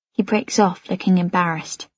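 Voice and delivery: ordinary delivery, nothing marked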